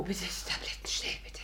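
breathless whisper, barely there; faint gasping plea. Acted. Covey fakes an attack to get the doctor out, breathless whisper. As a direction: fake a fainting fit, whispering.

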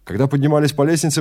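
usual speaking voice

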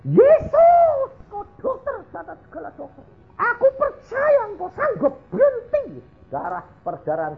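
menirukan istri berteriak histeris, nada melengking tinggi; teriak histeris. Retold or imitated. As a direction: seruan doa histeris bernada sangat tinggi. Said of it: "dia teriak-teriak kayak orang histeri". (menirukan jeritan orang histeris)

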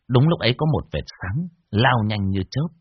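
ordinary delivery, nothing marked

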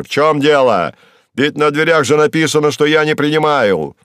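loud, brusque official voice; irritated boss. Acted. Boss in his office to an intruder, irritated rebuke. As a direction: rap it out loudly, annoyed at being disturbed.

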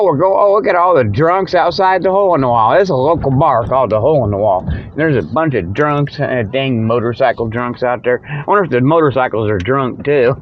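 ordinary talk, nothing marked

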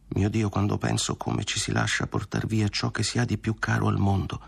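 plain reading voice

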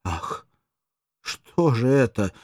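joy breaking through shock; sudden joy. Acted. Mirdza cries out in sudden joy. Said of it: "вскричала со внезапной радостью".

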